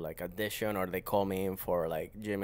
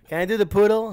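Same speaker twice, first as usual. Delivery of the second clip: higher and louder, laughing as he asks; laughing high plea; 11 semitones higher, 11 dB louder than usual. Retold or imitated. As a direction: beg for the poodle part, cracking up. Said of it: "I was like".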